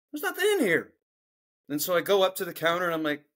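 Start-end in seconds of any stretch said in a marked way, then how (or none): 0.1-0.9 surprised. Retold or imitated. Finds the empty box.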